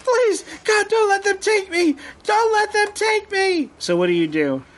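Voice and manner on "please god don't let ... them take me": high-pitched panicked screams for help; terrified screaming. Acted. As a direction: scream and plead for rescue in a much higher voice. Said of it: "he just starts screaming".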